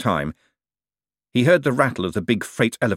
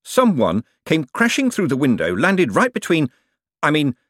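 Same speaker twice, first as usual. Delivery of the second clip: high, agitated shout; a mildly raised, pitched-up protest; 6 semitones higher, 4 dB louder than usual. A narrator's reading. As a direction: call out indignantly. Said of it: "shouted the man in the dressing gown".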